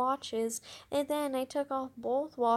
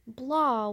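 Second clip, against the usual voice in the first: loud spitting blah; 6 dB louder than usual. Acted. Brother spitting gum across floor, loud disgusted blah. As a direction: spit the word out in disgust. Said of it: "went Stink, spitting the gum".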